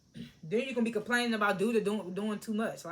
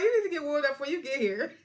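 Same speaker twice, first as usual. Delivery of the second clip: higher, mocking take on Gemma; imitating Gemma; 5 semitones higher than usual. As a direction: mimic her bossy line in a put-on voice. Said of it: "She was like".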